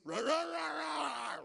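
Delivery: loud, high shouted rah; barking roar. Pretend. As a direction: roar at him like a wild animal. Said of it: "Just give him one of these".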